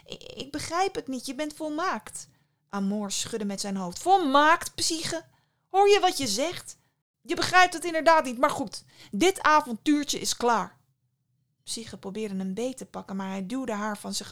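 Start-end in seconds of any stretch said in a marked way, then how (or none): 0.0-2.3 high, hesitant plea; timid, pitched-up bewilderment; cautious, faltering. A narrator's reading. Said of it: "bracht Psyche voorzichtig uit". 4.0-10.8 high, loud indignant retort; heated dismissal, raised voice; angry scolding. A narrator's reading. Amor rejects Psyche in anger.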